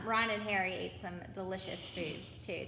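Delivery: ordinary delivery, nothing marked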